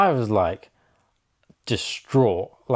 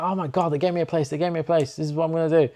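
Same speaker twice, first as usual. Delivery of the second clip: higher and fast, giddy; telling everyone about the new job, excited and higher; 6 semitones higher, faster than usual. Retold or imitated. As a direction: gush the news to everyone.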